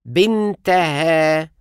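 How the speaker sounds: بنتها said slowly as bin-te-ha, open a before ha; slow pronunciation demo. Shown as a demonstration. Teacher slowly models the ha ending for learners. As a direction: spell out بنتها slowly as binteha. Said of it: "فتحة زائد ها".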